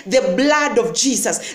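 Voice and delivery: ordinary delivery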